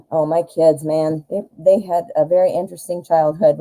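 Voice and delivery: normal talking voice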